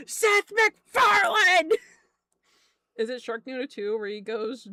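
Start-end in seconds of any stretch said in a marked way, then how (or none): 0.1-1.7 high, loud drawn-out yell; calling out a name. Pretend. Streamer yelling a celebrity's name at chat, loud mock-outraged yell.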